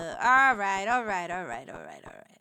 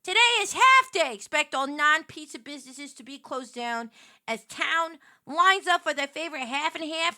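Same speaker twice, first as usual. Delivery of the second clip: news anchor; 4 semitones higher, 5 dB louder than usual. Pretend. Mock newscast about pizza.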